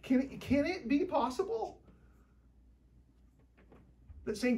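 ordinary delivery

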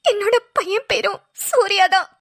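slow, tearful confession. Acted. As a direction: say it slowly through tears. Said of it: "ஏன் அழுவுறீங்க?". (அழுகையோடு மெதுவாக)

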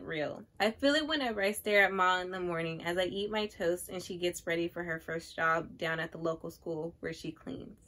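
soft, measured book reading; literary read-aloud. Acted. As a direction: read the passage quietly, like poetry. Reads a book passage aloud.

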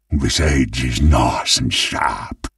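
usual speaking voice

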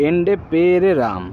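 এন্ডে পেরে রাম said slowly, each syllable spelled out for learners; slow Malayalam demo. Shown as a demonstration. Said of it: "তো সেটা মালায়ালাম ভাষা হয়ে যাবে".

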